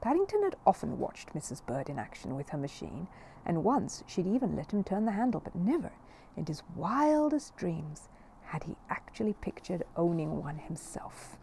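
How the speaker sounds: ordinary delivery, nothing marked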